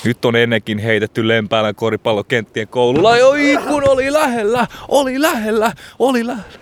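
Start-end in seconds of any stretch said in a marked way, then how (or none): 3.1-6.5 excited near miss. Pretend. Commentator on the near miss, high and excited. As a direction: shout how close it was.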